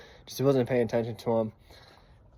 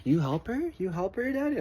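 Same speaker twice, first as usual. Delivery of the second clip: lightly voiced, higher curious little boy; son's voice; 9 semitones higher, 4 dB quieter than usual. Small son asking Daddy about helping the girl, a light child voice. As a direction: ask it like a puzzled small kid. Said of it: "and he's like".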